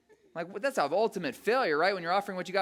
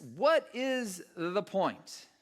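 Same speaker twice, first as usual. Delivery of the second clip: "what is the point" very slow, word by word; emphatic exasperation; 2 semitones higher, much slower than usual. Acted. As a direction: separate every word of "what is the point" in frustration. Fed-up person repeats question emphatically.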